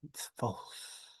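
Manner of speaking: a soft unvoiced whisper; whispered answer. Player whispering the answer to the puzzle, unvoiced. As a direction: breathe it out secretly.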